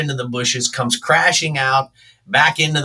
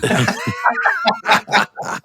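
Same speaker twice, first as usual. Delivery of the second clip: high-pitched laughter; high, wheezy burst of laughter; laughing. Real, not acted. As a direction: burst out laughing after the punchline. Laughing at own story.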